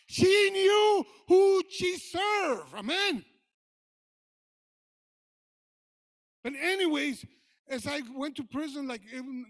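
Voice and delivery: loud, high preacher's cry, slowing to a shout; rising sermon shout; fiery preaching. Acted. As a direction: preach it out to the congregation at full force. Preaching builds to full force.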